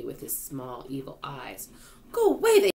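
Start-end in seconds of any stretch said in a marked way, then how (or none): 2.1-2.7 high, loud shout; frantic high yell. A narrator's reading. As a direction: shout to drive it off. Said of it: "they shouted".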